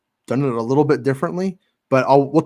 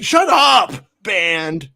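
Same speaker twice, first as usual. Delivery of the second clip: a high, mock-angry shout; mock snap; 6 semitones higher, 4 dB louder, much slower than usual. Mock-yelling back at a pestering fan, high and loud. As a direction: bark it back in fake annoyance. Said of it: "And I'll be like".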